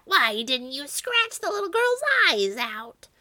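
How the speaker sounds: enraged witch, high screech; high, angry witch voice; Baba Yaga. A narrator's reading. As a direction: screech the accusation high and furious. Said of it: "jumped at the cat".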